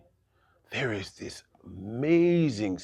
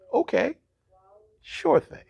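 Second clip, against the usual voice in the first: sly high agreement; 6 dB louder than usual. Acted. Laban slyly agreeing to deal.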